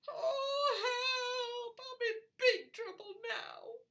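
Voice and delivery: high, loud wail of distress. Acted. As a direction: cry out for help, wailing. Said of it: "wailed Poppy".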